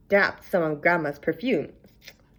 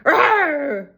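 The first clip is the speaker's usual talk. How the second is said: loud storybook roar; a big playful wolf roar; 8 semitones higher, 7 dB louder than usual. A narrator's reading. Wolf leaps from bed at the girl with a loud roar. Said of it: "roared the wolf".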